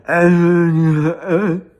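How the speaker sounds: drawn-out unintelligible phone mumble; exaggerated slurred gibberish; mock garbled mumble. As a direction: imitate a clerk mumbling into the phone. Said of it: "What the fuck did you say?".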